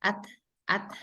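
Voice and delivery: "at" with a little breath released after the t; aliran napas keluar. Shown as a demonstration. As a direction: end "at" on a breathed t. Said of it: "dia keluar aliran nafasnya". ("at" dengan sedikit napas keluar di huruf t)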